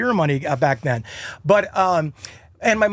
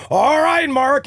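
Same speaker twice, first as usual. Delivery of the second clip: high, louder mock radio-host hype; morning zoo DJ; 8 semitones higher, 4 dB louder, much slower than usual. Morning radio hosts greeting the guest, mock DJ hype. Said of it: "every morning zoo team would just go".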